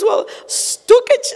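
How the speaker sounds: high, slow mock Richard drawing out stukać; imitating husband. Wife mimicking Richard's slow, high stukać. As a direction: mimic him slowly trying stukać. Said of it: "He goes".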